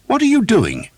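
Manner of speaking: very high, loud outraged demand. A narrator's reading. Priest confronting the knight, loud demand. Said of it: "the priest demanded".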